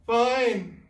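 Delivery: high, loud call; called back. Acted. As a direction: shout back a fib. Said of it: "Fergus called back".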